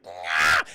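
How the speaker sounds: loud, low imitated growling yell; short mock battle cry; effort yell. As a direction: grunt it out as if hurling something. Imitates girl hurling a pipe.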